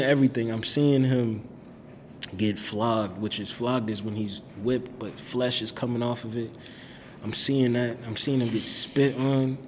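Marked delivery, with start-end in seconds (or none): none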